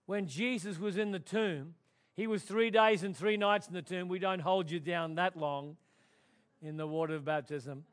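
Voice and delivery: usual speaking voice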